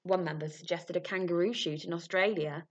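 usual speaking voice

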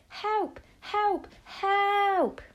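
high-pitched repeated cries, the last drawn out; desperate high calls, building to a long wail; screaming for help. A narrator's reading. Cornered boy hoping somebody outside might hear, screaming for help. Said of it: "I began to scream".

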